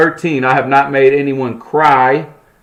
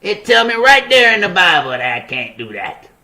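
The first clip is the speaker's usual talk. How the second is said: higher mock whiny churchgoer voice; 6 semitones higher than usual. Mocking Christians who say they can't stop sinning, in a higher whiny voice. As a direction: imitate a defeated believer, whining. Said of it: "a lot of Christians gonna be like".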